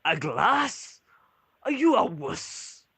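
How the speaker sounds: high, slow scoffing dwarf; mocking dwarf barkeep. Dwarf barkeep mocks the player for wanting a glass, high and scoffing. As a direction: tease the customer for asking for only a glass. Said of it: "He goes".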